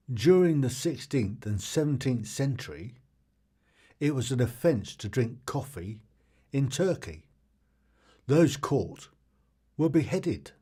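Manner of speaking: loud, projected fact reading; fact announcer. Real, not acted. Quiz host reading trivia to the audience, loud announcer delivery. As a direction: announce each phrase loudly, with clear pauses.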